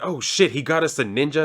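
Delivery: playful imitation of freaking out; mock freak-out. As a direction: act out a startled henchman, lightly. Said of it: "they're, like, freaking out".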